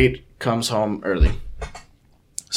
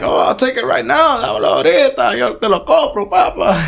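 eager roommate; 12 semitones higher than usual. Retold or imitated. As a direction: mimic him in a high, excited Spanish-English voice. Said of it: "he's like".

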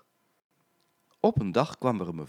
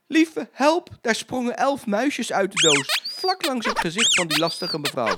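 high, loud burst of alarm; alarmed narration; 12 semitones higher, 7 dB louder than usual. A narrator's reading. Storyteller as mice leap from the box, alarmed. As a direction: exclaim it in sudden commotion.

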